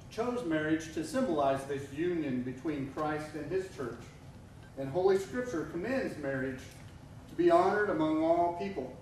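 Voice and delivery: normal talking voice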